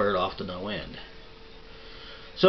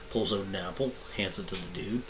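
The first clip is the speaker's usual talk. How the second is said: ordinary talk, nothing marked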